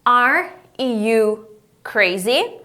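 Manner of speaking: "Are... you... crazy?" very slow, rising at the end; drawn-out teacher model of "Are you crazy?"; word-by-word question. Shown as a demonstration. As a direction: separate each word, then lift "crazy".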